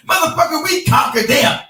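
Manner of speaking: hammed-up offended pride; indignant rant. Retold Spaniard's angry rant.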